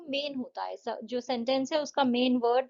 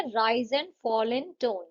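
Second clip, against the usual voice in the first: slow definition; much slower than usual. Shown as a demonstration. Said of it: "intonation क्या है?".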